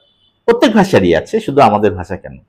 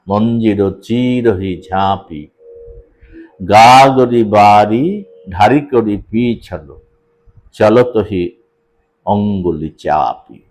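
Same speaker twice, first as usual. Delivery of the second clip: very slow, stretching the syllables; slow metrical chant; drawn-out recitation; 4 semitones lower, much slower than usual. Shown as a demonstration. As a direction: chant the old verse, long vowels held. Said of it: "এই যে টেনে পড়ছি কোথাও কোথাও".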